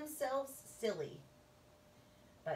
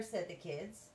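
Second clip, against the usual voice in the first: ordinary delivery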